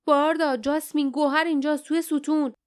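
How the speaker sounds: high, excited calling out; urgent shout. A narrator's reading. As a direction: shout the discovery to your companions. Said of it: "فریاد زد".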